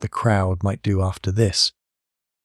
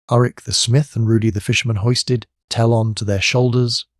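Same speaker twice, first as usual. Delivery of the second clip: ordinary delivery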